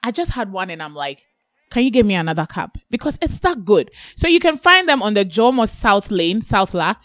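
upbeat radio ad voice; ad read. Acted. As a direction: sell it like a radio spot. Host reads sponsor ad over music.

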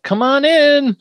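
high, drawn-out call to a visitor. Retold or imitated. Thatcher answers banging on the sarcophagus, calling out. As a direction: call toward the door, high and long. Sleeper woken by banging calls out.

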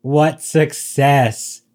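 slow, dry sarcastic praise. Acted. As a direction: drag it out with mock awe.

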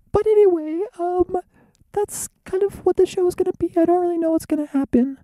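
very high squeaky voice; squeaky cartoonish falsetto; silly falsetto. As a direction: chatter in a piercing comic falsetto.

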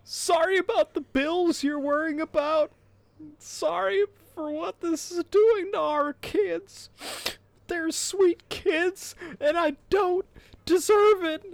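hammed-up tearful whine; mock crying voice. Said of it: "in your best crying voice".

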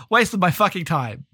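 ordinary talk

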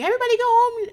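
high-pitched imitated holler; mock scream. Recapper acting out a party being cleared, mock scream. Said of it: "Ramona is screaming".

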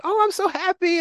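high excited squeal; excited scream. Retold or imitated. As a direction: squeal it like a thrilled child. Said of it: "my goddaughter at the time was screaming".

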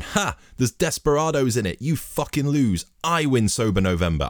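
high, mocking gloat with a triumphant "Ha". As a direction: crow over the loser. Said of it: "he was standing over me going".

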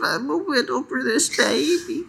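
put-on very high, tiny British child; British baby voice. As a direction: squeak it like a little British toddler. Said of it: "That's my British baby voice".

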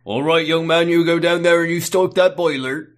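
put-on gruff old-time boss order. Boss sending young man to the boiler, loud mock command. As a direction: order the lad about with gusto.

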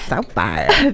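laughing, jaw held open; mouth propped open. Shown as a demonstration. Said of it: "children doing this".

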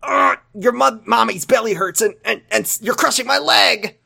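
high, halting strained protest; pained mother. Acted. Mother with sore belly to hugging son, pained and high. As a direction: fend off the child through discomfort.

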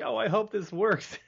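high-pitched mock nervous aside; lightly acted jitters, pitched well up; imagined nervous energy. Imagining the actor drawing on nerves, mock nervous hope. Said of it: "drawing on that nervous energy".

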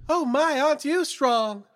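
put-on high, fluttery old woman; old lady voice. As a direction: gush like a genteel old lady. Old lady character reunion.

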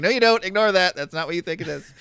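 squeaky, high protest; high panicky denial. Acted. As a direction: play innocent in a hurry. Acted player denying caught secret.

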